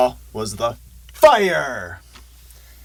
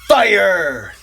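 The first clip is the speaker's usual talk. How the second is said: echoing fire; 7 semitones higher, 5 dB louder than usual. Acted. Dramatic emphasis on fire.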